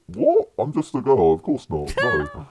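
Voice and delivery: very high, incredulous start, then easing down; imitated innocent denial. Friend denying to the guard she's with them, mock-innocent denial. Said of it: "And she's like".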